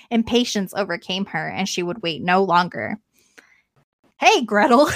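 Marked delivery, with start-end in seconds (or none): 4.2-4.9 loud, higher call of the witch; witch shouting. A narrator's reading. Witch shouting at Gretel, loud high call. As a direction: call the girl sharply like the witch. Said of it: "she shouted to the girl".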